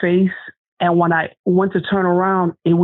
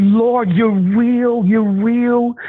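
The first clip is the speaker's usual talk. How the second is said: higher, drawn-out plea to the Lord; retold tearful cry to God; 5 semitones higher, much slower than usual. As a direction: recall a tearful prayer, stretching the words in awe. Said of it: "I just kept on crying and crying".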